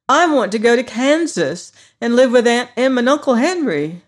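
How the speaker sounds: Dorothy voice. Acted. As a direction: plead to go home.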